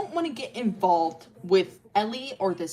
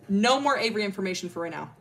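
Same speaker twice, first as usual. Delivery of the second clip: ordinary talk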